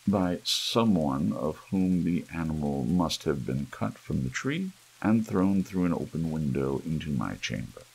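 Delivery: ordinary delivery, nothing marked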